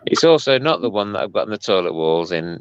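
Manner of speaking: normal talking voice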